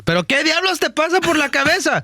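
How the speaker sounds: high-pitched Spanish scolding yell; angry mom imitation. Son imitating mom cussing him out, furious high yelling. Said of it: "do an imitation real quick".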